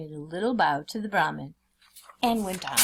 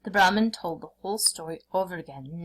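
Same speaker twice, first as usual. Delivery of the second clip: ordinary delivery, nothing marked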